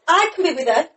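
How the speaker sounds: ordinary delivery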